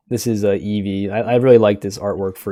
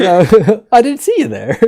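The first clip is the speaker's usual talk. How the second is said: mock innocent Mickey; 10 semitones higher, 6 dB louder than usual. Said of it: "oh yeah, he's like".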